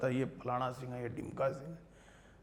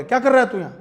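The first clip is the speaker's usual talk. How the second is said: blunt scolding question, loud and high; retold angry demand, pitched sharply up; imitated angry teacher; 11 semitones higher, 8 dB louder, faster than usual. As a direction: confront the student head-on as the cross teacher. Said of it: "गुस्सा करते कई बार".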